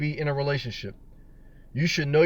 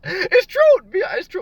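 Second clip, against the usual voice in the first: high squeaky it's true; squeaky agreement; 5 dB louder than usual. Real, not acted. Answering NDC's laugh, high squeaky agreement. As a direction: squeak it out amused. After NDC laughs out loud.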